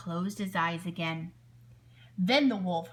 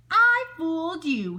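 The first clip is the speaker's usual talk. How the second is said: gloating; 8 semitones higher, 7 dB louder than usual. A narrator's reading. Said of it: "The young wolf just laughed".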